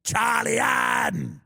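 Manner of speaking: slow, drawn-out mock holler; mimicked catchphrase shout. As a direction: stretch the name out like a bellowed catchphrase. Mimics a British TikToker's catchphrase.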